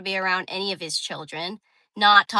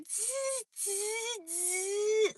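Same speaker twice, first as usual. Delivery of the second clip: rewind buzz; 10 semitones higher, 10 dB quieter, much slower than usual. Real, not acted. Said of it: "imitated rewind noise".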